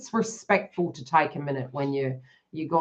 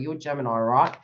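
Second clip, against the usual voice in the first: ordinary talk, nothing marked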